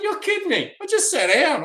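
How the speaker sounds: startled disbelief at the final call; incredulous. Retold or imitated. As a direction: react like the boarding call just ambushed you. Final call right after sitting.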